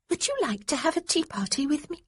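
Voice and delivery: put-on high, earnest child's invitation; a little girl asking very seriously; prim little girl. A narrator's reading. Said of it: "she said with complete gravity".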